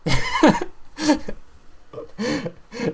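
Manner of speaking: high-pitched drawn-out laughing fit; helpless laughter. Real, not acted. Player cannot get over the turtle card, laughing helplessly.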